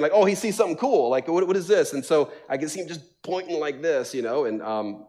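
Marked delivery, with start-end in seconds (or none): 0.1-1.9 curious excitement. Retold or imitated. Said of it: "looking around thinking".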